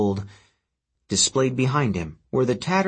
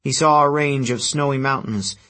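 plain reading voice

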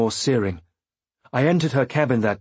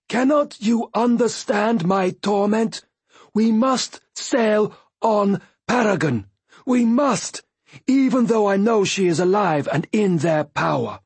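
higher, slow, pleading in torment; anguished Fool; 10 semitones higher than usual. A narrator's reading. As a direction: voice the Fool's anguish.

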